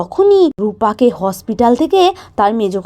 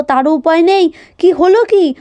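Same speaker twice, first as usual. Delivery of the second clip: plain reading voice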